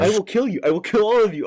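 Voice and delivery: loud, high mock yell of a threat; mock death threat. Host yelling mock threats at interrupting friends, loud and high. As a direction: bellow it like a fake-furious host.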